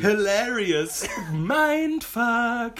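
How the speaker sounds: slow, high, loud mock praise; sarcastic. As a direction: drawl it out sarcastically. Mocking boomerang viewers.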